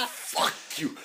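whispered, laughing comeback; hushed retort. Real, not acted. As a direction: drop to a whisper after the laugh. Mock retort after being teased.

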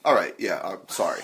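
usual speaking voice

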